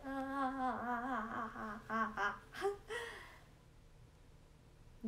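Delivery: long wavering string of "ah"s; slow repeated "ah" breaking into a laugh; drawn-out "ah". Real, not acted. Streamer after begging viewers to watch, wailing "ah" playfully.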